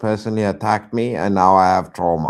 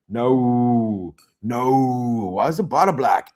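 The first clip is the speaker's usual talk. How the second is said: ordinary delivery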